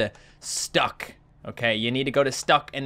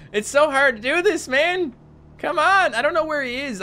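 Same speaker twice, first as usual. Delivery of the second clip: much higher, strained frustration; frustrated whine; 10 semitones higher than usual. Real, not acted. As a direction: whine at the game in real frustration. Frustrated hunting for shark.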